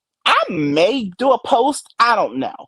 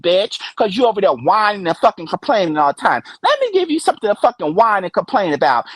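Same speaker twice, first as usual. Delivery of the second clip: ordinary talk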